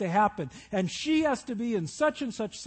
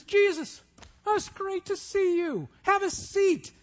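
high, bright-hearted welcome, much higher pitch; cheerful Mary; 12 semitones higher, 5 dB louder than usual. Acted. Preacher voicing Mary welcoming Jesus, high and eager. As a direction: greet him like a delighted hostess.

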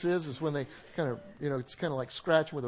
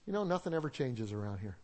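cynical mutter; 4 semitones lower, 6 dB quieter than usual. Retold or imitated. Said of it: "Some people are cynical".

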